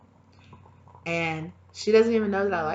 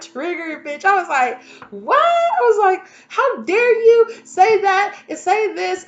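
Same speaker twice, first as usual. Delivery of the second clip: much higher and louder, indignant yelling; mock outrage; 13 semitones higher, 12 dB louder, slower than usual. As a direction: rant at the webpage in disbelief. Retold outrage at webpage.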